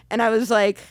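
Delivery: ordinary delivery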